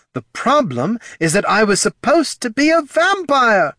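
high, loud, furious complaint; angry snarl. A narrator's reading. Batel's shade rages at being no vampire, snarling. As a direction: rage in a very high voice. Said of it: "she snarled".

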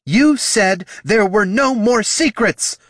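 slow, high, loud fury; drawn-out furious accusation; bellowing. Acted. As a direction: bellow it at someone's back. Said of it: "the Solomancer bellowed at Jin's back".

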